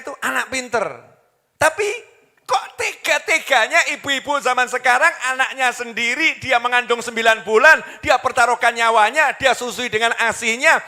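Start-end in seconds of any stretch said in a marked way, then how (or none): 1.5-10.8 loud, higher, driving sermon build; impassioned preaching. Real, not acted. Preacher reproaching mothers of today, impassioned preaching. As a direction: preach it at full force, indignant.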